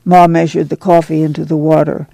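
ordinary delivery, nothing marked

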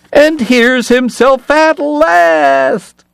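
high, slow, booming music-hall call; stage announcer voice. Acted. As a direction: announce him like a showman. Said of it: "In the voice that filled the comique".

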